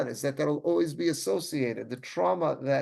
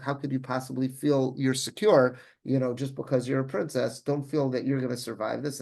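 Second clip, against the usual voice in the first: ordinary talk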